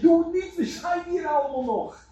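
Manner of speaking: high, loud call to stop him; Paul shouting to the jailer not to harm himself; urgent shout. Acted. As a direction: cry out to stop a man from killing himself. Paul stopping the jailer's suicide.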